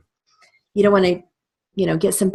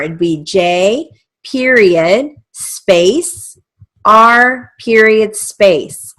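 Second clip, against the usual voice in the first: J period space, R period space, slow and louder; dictating; 4 semitones higher, 9 dB louder, much slower than usual. Shown as a demonstration. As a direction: spell out "period space" slowly. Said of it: "let's say it's J R".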